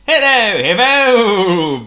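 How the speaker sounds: loud call past the window; booming hello. A narrator's reading. Potto flies past Hippo's office, booming call. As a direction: boom it out cheerfully. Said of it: "boomed Potto".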